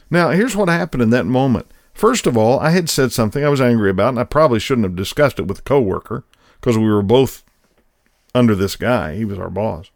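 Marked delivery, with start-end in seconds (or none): none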